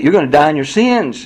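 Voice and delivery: ordinary delivery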